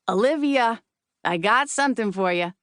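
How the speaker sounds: put-on a light raspy Queens accent, blurted out; hint of a gravel-voiced Queens booker. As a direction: spit it out like a New York agent from Queens. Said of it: "spits out in her raspy Queen's accent".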